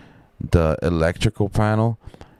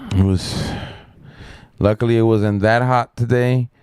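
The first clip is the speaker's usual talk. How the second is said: ordinary delivery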